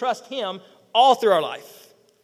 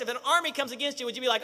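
ordinary delivery

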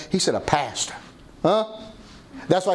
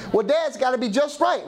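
hint of a particular youngster, pitched up; imitated fussy son; 8 semitones higher than usual. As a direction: lightly mimic a son justifying himself, higher. Imitates son fussing over his hair.

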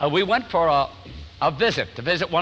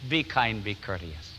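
soft repeat; 8 semitones lower, 6 dB quieter than usual. Acted. Repeating the verse softly.

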